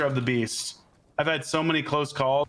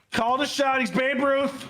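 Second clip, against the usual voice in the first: much higher, hyped-up praise; excited cheer; 12 semitones higher than usual. Real, not acted. Gamer hypes up his friend's headshot with an excited high cheer. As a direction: cheer him on like a sports fan. Cheering teammate's shot in game.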